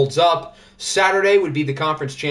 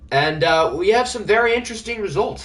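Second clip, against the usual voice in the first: ordinary talk, nothing marked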